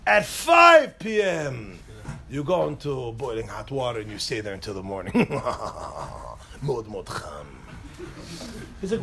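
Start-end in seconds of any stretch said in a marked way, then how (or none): 0.1-1.8 drawn-out, higher booming call; mock dramatic announcement. Keeper of Israeli hell announcing the hour, dramatic drawn-out shout. 2.3-7.5 low, soft villain delivery with evil laugh; mock menace. Keeper of Israeli hell threatening boiling water, low mock menace.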